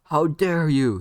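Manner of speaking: loud, high burst of outrage; enraged. Acted. Sasuke outraged at Naruto's insult, enraged. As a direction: explode in offended anger. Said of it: "Sasuke would get enraged".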